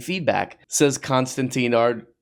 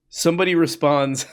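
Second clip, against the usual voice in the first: ordinary talk, nothing marked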